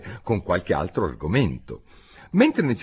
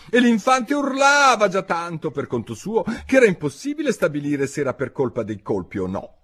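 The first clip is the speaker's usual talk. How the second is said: ordinary delivery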